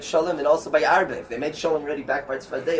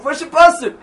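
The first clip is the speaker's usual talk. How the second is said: higher, louder call for a verse; demanding challenge; 13 semitones higher, 11 dB louder than usual. Retold or imitated. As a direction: challenge him to show the verse. Shabbat Rav demands a source.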